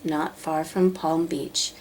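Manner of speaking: ordinary delivery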